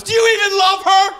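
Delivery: high, loud mock outrage; imitating a wife's shrill protest; indignant wife. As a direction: shout it as the appalled wife. Wife's indignant challenge, acted out.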